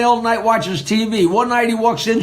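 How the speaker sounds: ordinary delivery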